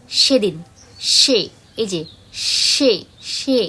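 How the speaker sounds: সেদিন and সে with air hissing over the teeth; a long, clean hissing s; teacher demonstrates the right s; airy dental s. Shown as a demonstration. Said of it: "একটা হাওয়া touch করবে".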